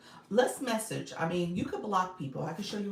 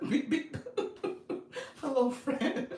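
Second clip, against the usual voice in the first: higher, giggling beep beep; giggly beeps; 8 semitones higher, slower than usual. Real, not acted. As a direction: beep beep through high laughter. Laughing at DJ beep joke.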